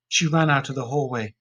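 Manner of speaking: plain reading voice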